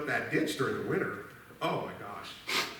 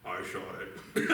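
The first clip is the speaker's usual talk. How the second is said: sheepish whisper; 6 semitones lower, 4 dB quieter, slower than usual. Retold or imitated. Confessing the goose shot.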